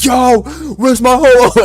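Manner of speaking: high, drawn-out mock yell of outrage; imitated yelling. Robbed player screaming over voice chat, mimicked yell. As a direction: wail it like a robbed kid on voice chat. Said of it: "the dude started yelling".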